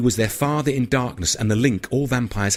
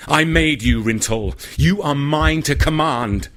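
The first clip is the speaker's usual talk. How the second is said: higher and louder, laying claim to him; commanding; 5 semitones higher, 4 dB louder, slower than usual. Acted. Vampire sire asserts command over his newborn, loud and high. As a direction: proclaim ownership, slow on the last words.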